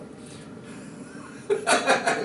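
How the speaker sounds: high unvoiced giggle; amused wheezing laugh. Real, not acted. Laughs mid story.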